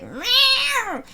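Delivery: high mock cat meow; a sudden high meow. Friend's cat meowing before it attacks.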